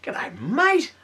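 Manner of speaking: high, put-on broad Aussie "G'day, mate."; hammed-up Aussie greeting. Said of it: "he's the Kiwi among us".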